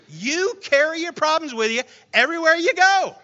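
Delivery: loud, high, fast with laughs; preaching at full force. Acted. Preacher to the congregation about carrying problems, impassioned. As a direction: drive the point home.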